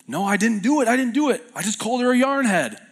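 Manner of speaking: high-pitched mock denial of an accused kid; imitating a boy's indignant denial; protesting schoolboy. Voices boy denying the accusation.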